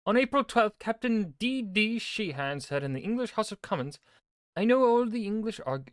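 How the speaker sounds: high, booming political speech; loud oration. Acted. Reader declaims an MP's House of Commons speech, loud oration. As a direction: declaim it loudly to a full house.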